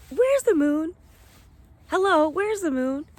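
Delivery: high, singled-out mock search call; playful calling out. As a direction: call out high and a bit loud, as if hailing someone missing.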